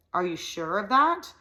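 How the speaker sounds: a hint of a pinched-nose voice; nasal disguised voice. A narrator's reading. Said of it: "her voice came out as a nasal".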